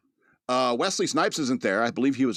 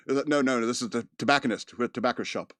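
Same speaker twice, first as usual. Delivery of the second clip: usual speaking voice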